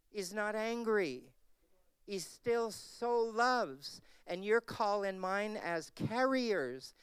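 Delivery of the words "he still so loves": slow, with SO lifted high; slow emphatic stress. Acted. Preacher stressing that God still so loves. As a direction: draw out "so loves" as the point.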